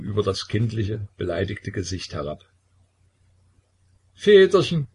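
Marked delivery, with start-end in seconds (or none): 4.1-4.9 high, loud sudden cry; exclaiming. A narrator's reading. Possessed Kilikea crying out to Kornelij, high and loud. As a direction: burst out as if struck by a thought. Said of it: "rief Kilikea die Besessene aus".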